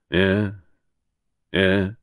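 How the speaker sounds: short grunted acknowledgements on the phone; inspector's minimal grunted replies; grunting. A narrator's reading. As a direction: grunt each "yeah" while listening. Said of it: "He was grunting".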